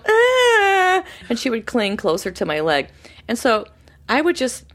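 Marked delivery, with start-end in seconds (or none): 0.1-1.0 high loud frightened little ah; startled cry. Retold or imitated. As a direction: shrink back with a sudden cry. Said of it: "And she would go".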